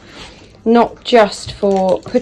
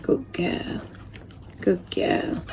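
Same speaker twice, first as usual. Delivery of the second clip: soft whisper to the puppy; whispered praise; 12 dB quieter, much slower than usual. Real, not acted. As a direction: hush it so she keeps eating. Softly praising eating puppy.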